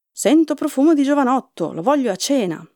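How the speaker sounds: mild storybook roar, starting high; roaring giant. A narrator's reading. Giant sniffing out a boy for dinner, mildly roaring. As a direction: lightly roar like a hungry ogre, then ease off. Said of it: "il gigante ruggì".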